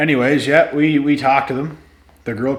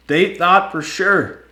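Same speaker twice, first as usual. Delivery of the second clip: higher, louder and drawn out; slow gloating; 10 semitones higher, 7 dB louder, slower than usual. Real, not acted. Repeating to viewers the cops were wrong, slow and louder. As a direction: stretch it out, savouring the win. Gloating climax of the breathalyzer story.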